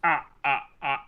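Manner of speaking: spooky counting chuckle; count laugh. Pretend. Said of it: "doing the count laugh".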